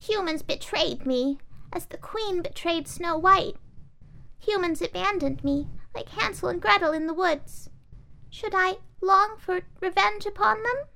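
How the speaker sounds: high, urgent doll voice. Acted. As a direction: press the question urgently. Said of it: "The doll spoke more urgently".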